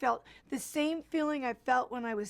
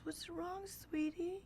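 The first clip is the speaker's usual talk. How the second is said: soft, higher-pitched coaxing; tender concern; 7 semitones higher, 10 dB quieter, slower than usual. Retold or imitated. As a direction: imitate a kind stranger gently checking on someone upset. Kind stranger consoles crying girl.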